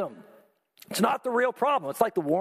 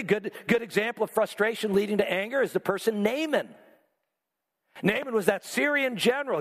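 usual speaking voice

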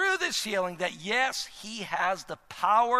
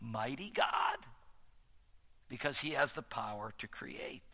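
hushed, breathy whisper, much softer; dramatic whisper; 9 semitones lower, 13 dB quieter than usual. Real, not acted. Pastor to congregation, hushing for a reverent whisper. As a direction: lean in and whisper it to the crowd.

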